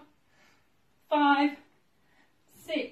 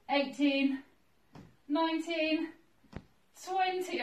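straining; 4 semitones higher, slower than usual. Real, not acted. Straining through hip thrust reps.

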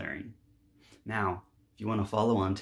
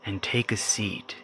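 slow, deliberate meditation voice with long pauses; guided meditation; 7 dB louder, slower than usual. Acted. Guiding listeners through clearing resentment, slow guided meditation. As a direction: guide listeners gently, phrase by phrase, leaving space.

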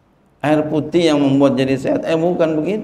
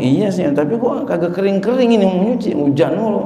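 mock grumbling; 6 semitones higher than usual. Woman complaining about laundry.

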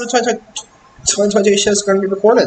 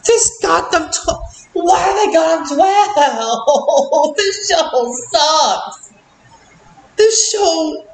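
high, stretched-out exasperation; exasperated disbelief; 7 semitones higher, slower than usual. Real, not acted. Reviewer complaining about a show placed twelfth, exasperated disbelief.

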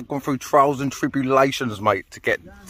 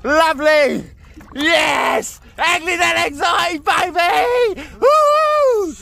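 much higher, louder whooping victory shout; triumphant cheer; 4 dB louder, much slower than usual. Real, not acted. Celebrating the catch to the camera, triumphant whoop. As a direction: scream it out in triumph.

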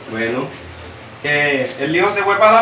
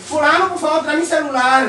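mock pleading guy; 5 semitones higher than usual. Guy calls for his phone.

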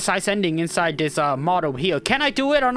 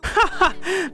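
laugh; 10 semitones higher than usual. Real, not acted. Laughing at game reaction.

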